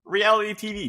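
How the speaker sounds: sudden high, loud burst of excitement; excited outburst. Real, not acted. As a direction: blurt it out like a lightbulb idea. Excited idea pitch.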